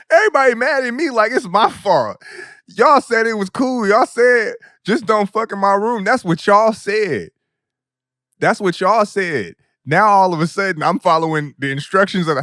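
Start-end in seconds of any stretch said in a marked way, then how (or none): 0.1-9.5 higher, louder indignant complaint; aggrieved, half-laughing protest. Real, not acted. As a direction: plead your innocence. Protesting the unfair blame.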